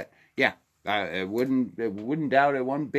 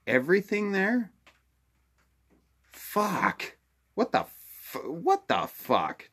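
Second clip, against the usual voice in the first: much higher, cracking into disbelief; shocked disbelief; 9 semitones higher than usual. Real, not acted. Reacting to Lahaina burning down, much higher, shocked. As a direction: reel at the news, voice jumping up.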